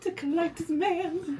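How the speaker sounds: high-pitched delighted squeal; excited squeal. Real, not acted. Fan squealing at drama scene.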